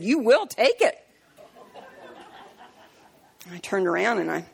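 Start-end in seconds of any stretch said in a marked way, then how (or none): 0.0-1.1 retold angry demand. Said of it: "he slammed that ten dollar bill".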